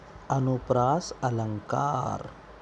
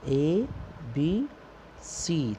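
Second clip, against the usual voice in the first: slow spelled pattern; 2 semitones higher, much slower than usual. Shown as a demonstration. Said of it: "तीसरी line क्या आई".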